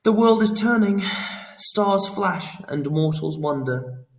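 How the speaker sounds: a trickster declaiming mysteriously; cryptic Pan. A narrator's reading. Pan dodging Phoenix's question, grandly cryptic.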